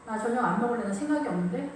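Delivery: ordinary talk, nothing marked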